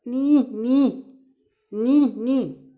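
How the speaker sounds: loud, high neighs in pairs; teacher imitating a horse's whinny. Shown as a demonstration. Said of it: "horse कैसे बोलता है".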